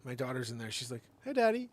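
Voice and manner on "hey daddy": put-on much higher, cheerful child; high sweet child greeting; little girl voice. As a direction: chirp it like a small girl. Small daughter inside the dog.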